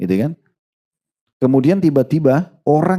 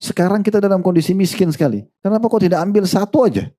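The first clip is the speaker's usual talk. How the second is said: lightly voiced wife, pitched higher, pleading; a poor wife urging her husband to take one coin, voice raised higher; a hint of a wife's anxious plea, pitched higher; 8 semitones higher than usual. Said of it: "istrinya bilang".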